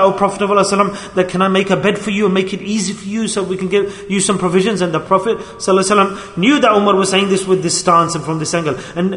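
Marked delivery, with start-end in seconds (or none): none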